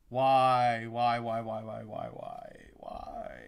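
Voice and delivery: why fading to a whisper; despairing why. Real, not acted. Fan moaning at his team's choice. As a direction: let it die into breath. Despair over lost pick.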